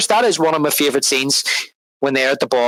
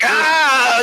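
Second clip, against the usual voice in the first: mock Penguin cry; 11 semitones higher than usual. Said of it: "And he's like".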